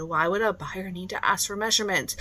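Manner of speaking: ordinary delivery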